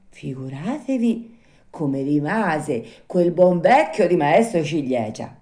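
plain reading voice

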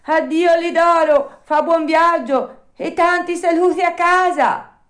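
high, loud farewell shouted across the water; calling out goodbye. A narrator's reading. Pinocchio shouting goodbye to the dog from the water, high and loud. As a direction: call it out from the sea. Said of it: "gridò all'amico salvato".

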